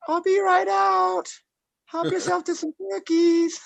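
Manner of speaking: very high, slow sing-song lure voice; background voice. Retold or imitated. TV sting decoy calling from the back room, very high and sweet. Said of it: "that voice in the background that was like".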